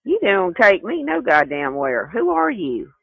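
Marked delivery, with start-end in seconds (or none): none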